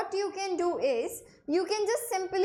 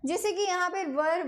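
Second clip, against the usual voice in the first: ordinary talk, nothing marked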